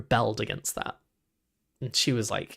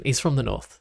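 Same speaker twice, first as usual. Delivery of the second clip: normal talking voice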